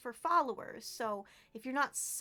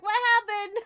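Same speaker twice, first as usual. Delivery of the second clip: higher and louder, alarmed question; mock-alarmed what happened; retold alarm; 9 semitones higher, 10 dB louder, faster than usual. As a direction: replay the moment of panic. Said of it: "I was like".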